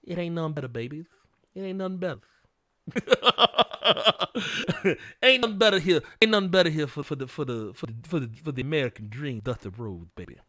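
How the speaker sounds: a hint of Dusty Rhodes cutting a promo; Dusty Rhodes impression. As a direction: streamer doing a playful Dusty Rhodes bit for chat. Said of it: "let me put on my Dusty Rhodes voice".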